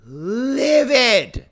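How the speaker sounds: drawn-out "livid", high and loud; livid outburst. Retold or imitated. Telling friends about his stolen towel spot, livid. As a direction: stretch it into an outraged swell. Telling how furious he was.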